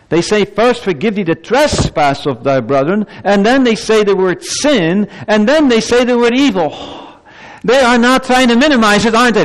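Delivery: very high, forceful pulpit delivery; impassioned preaching. Real, not acted.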